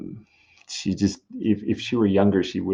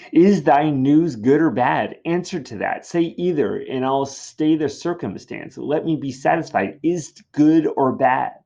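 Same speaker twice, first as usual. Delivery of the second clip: higher, louder, insistent demand; impatient Juliet; 6 semitones higher, 6 dB louder than usual. Acted. Juliet insisting on good or bad, impatient. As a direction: demand a straight answer. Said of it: "Julia is very impatient".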